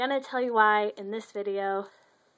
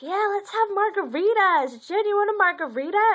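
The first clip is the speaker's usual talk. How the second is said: excited invite; 9 semitones higher, 5 dB louder than usual. Retold or imitated. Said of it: "my mother and my aunt were like".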